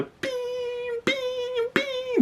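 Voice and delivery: very high, soft, ringing bings; imitated ringing vibration. Storyteller describing punches vibrating in his head, imitated high ringing. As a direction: mimic each punch as a thin high-pitched tone. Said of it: "That's what it sounded like".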